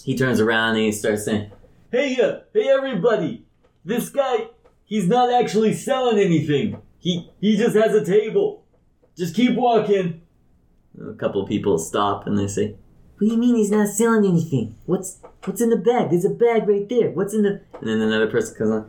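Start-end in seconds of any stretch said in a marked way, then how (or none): 1.9-10.2 high-pitched hawker calling out to the crowd; high NPC voice. Acted. Bystander calls to the passing crowd, high and eager. 13.2-17.6 high, nosy onlooker voice; high crowd voice. Acted. Curious passerby asks about the bag, high and nosy.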